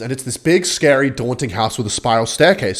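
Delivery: ordinary talk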